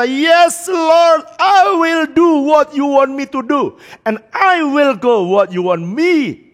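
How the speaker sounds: slow tearful "Yes Lord"; tearful surrender. Retold or imitated. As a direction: give in to God with a breaking voice. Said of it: "angkat tangan dan berkata".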